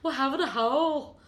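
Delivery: put-on northern English, Manchester style; Manchester accent. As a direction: imitate a broad Mancunian woman. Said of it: "Manchester accent I've ever heard".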